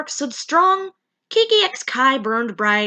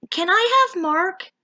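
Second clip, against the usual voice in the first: higher, timid request; shy Mimi; 9 semitones higher than usual. Acted. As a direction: ask nervously, pitched up. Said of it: "Mimi asked, wringing her tail".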